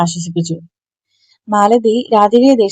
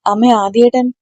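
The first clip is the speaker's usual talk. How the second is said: plain reading voice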